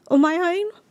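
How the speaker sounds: high, tear-choked voice; talking through real tears; crying. Real, not acted. Recalling crying alone in car.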